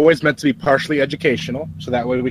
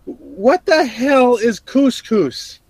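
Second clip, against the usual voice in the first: baffled; 9 semitones higher, slower than usual. Real, not acted. Baffled outburst.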